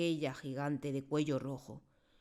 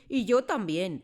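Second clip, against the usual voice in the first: loud thundering retort; loud booming comeback; thundered; 6 semitones higher, 10 dB louder, slower than usual. Acted. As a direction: boom it back at her. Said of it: "tronó la señorita Trunchbull".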